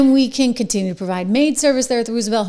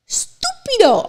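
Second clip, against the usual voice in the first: mock Italian; 12 semitones higher, slower than usual. Mocking AOC as stupid.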